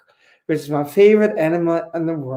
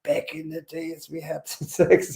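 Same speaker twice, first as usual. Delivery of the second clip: grandpa; 3 semitones lower, 8 dB quieter than usual. Retold or imitated. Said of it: "I feel like a grandpa talking to his kids".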